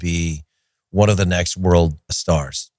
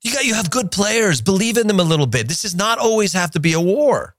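high, pleading mock scolding; exasperated plea to Simeone; 12 semitones higher than usual. Pundit begging Simeone to attack, exasperated high plea. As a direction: beg him to play some offense, pitched way up.

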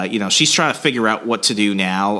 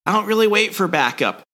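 cocky Valance voice; 10 semitones higher than usual. Retold or imitated. Valance brushing off Boba's orders, high and smug. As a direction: shrug it off with swagger. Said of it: "he's like".

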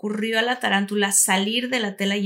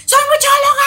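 much higher, loud squeal of a fan; excited fan; 11 dB louder than usual. Retold or imitated. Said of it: "se voltea esta chica y me dice". (fan emocionada gritando)

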